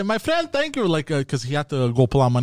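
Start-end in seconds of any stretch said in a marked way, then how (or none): 0.0-0.9 high mock call-out; retold yell. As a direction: imitate a mother calling across, higher. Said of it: "my mother yells out to".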